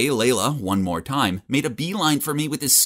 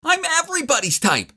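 shouting. Acted. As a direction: yell it back, offended. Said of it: "She shouted back".

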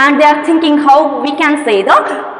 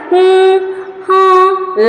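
two musing hums, slightly raised; doubtful hmm; 4 semitones higher than usual. Shown as a demonstration. Teacher showing the doctors' puzzled hums. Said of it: "like that they are doing".

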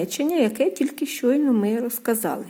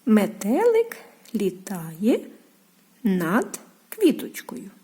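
slow reading; slower than usual. Shown as a demonstration. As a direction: read it slowly, fading to a whisper. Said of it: "поставили крапочку". (повільне читання речення)

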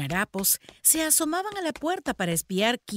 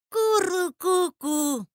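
high, slow cooing; boy attempting pigeon talk; pigeon call; 9 semitones higher, much slower than usual. A narrator's reading. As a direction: imitate a dove's coo in drawn-out syllables. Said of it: "exclamó Teddy".

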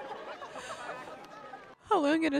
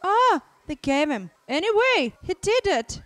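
loud, high burst of relief; excited relief; 4 semitones higher, 8 dB louder than usual. Real, not acted. Viewer cheering that he did it, excited relief. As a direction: exclaim it like a small victory. Elated that film hero succeeded.

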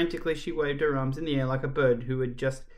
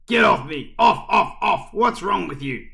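loud, higher cries of alarm; outraged protest; 6 semitones higher, 7 dB louder than usual. Acted. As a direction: shout it as if shoving off a hugger. Caretaker fighting off a hug.

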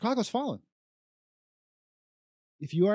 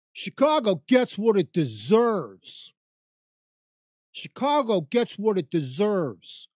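quoting leaders; 2 semitones higher, 5 dB louder, slower than usual. Retold or imitated. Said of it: "what they say to me".

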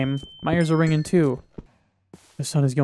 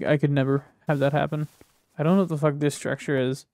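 ordinary talk, nothing marked

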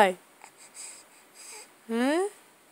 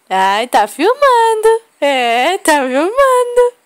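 much higher, slow cooing; 6 semitones higher, 5 dB louder, slower than usual. Real, not acted. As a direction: coo it slowly in a high voice. Mother cooing at her baby.

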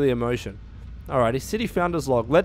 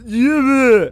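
loud, high mock jabbering; hammed-up, whiny yelling imitation; 8 semitones higher, 8 dB louder, much slower than usual. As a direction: mimic a rude man yapping. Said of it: "This guy's like".

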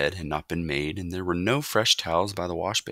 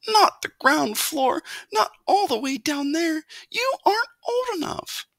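very high, wailing objection; mother's anxious cry; 3 dB louder than usual. A narrator's reading. Mother forbids son the ground floor, anxious cry. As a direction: wail it like a fretting mother mouse. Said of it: "cried his mother".

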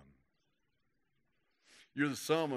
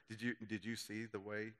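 hushed mock gossip; gossipy; 2 semitones lower, 10 dB quieter than usual. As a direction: imitate a gossip keeping it secret. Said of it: "little gossipy thing going on".

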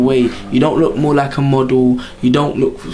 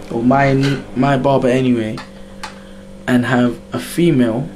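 ordinary delivery

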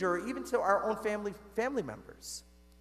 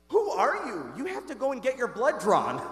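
amazed exclamation; 4 semitones higher, 5 dB louder than usual. Retold or imitated. Friend stunned, nurse retold.

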